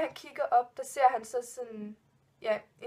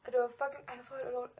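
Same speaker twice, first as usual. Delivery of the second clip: ordinary talk, nothing marked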